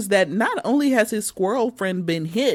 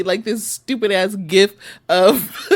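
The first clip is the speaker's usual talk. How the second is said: usual speaking voice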